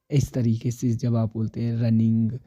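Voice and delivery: ordinary delivery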